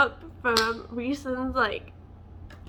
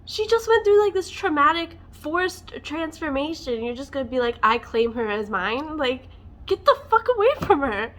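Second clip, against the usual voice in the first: high, loud, indignant outburst; outraged rant; 5 semitones higher, 7 dB louder than usual. Retold or imitated. Reader ranting at Lucien for claiming Feyre, loud outrage. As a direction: tear into him, incredulous and furious. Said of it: "I'm like".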